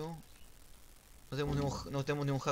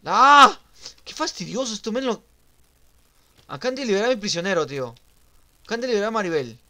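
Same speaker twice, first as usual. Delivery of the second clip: loud, high frustrated complaint; exasperated outburst; 7 semitones higher, 14 dB louder than usual. Real, not acted. Player whose prisoner was just freed, exasperated shouting. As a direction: yell in real annoyance.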